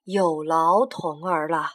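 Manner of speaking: polite tired traveller; scholar voice. Acted. Jia Dao thanks the boy.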